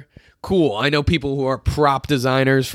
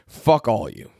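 ordinary talk, nothing marked

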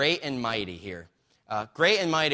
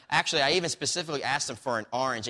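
ordinary delivery

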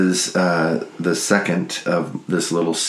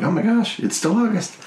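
high squeaky imitation. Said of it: "And you were like".

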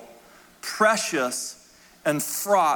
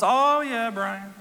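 high-pitched Southern drawl, tailing off; Southern lady; 6 semitones higher, much slower than usual. Retold or imitated. Widow hearing about the support group, in a Southern accent. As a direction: imitate a Southern lady answering politely. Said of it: "And she's Southern".

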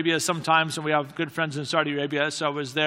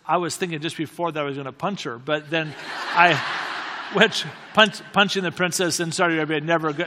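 normal talking voice

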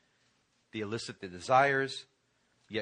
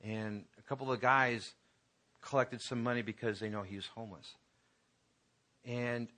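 ordinary talk

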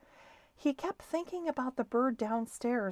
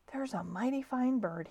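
usual speaking voice

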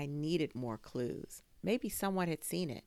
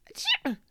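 sharp high sneeze; loud sneeze; 8 dB louder than usual. A narrator's reading. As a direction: sneeze loudly. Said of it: "Mom sneezed again".

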